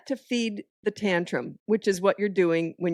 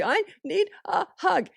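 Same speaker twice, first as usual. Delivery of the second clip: put-on child voice; 12 semitones higher, slower than usual. Child asking for hug, voiced.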